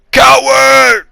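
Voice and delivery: loud, high, drawn-out imitated shout; mock yell. Hero yelling while running away, hammed-up high shout. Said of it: "and he's yelling".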